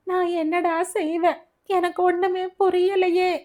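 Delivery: high, tearful lament; crying mother. Acted. Mother breaking down over her sick son, tearful high lament. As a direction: wail it out helplessly. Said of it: "கரைந்து அழுதாள்".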